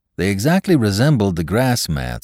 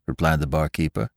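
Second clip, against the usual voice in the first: ordinary delivery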